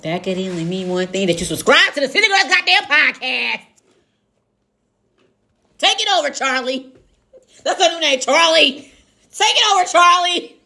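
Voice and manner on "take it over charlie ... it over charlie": high, loud, playful call; excited handoff. Real, not acted. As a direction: sing out the handoff. Hands over to co-host.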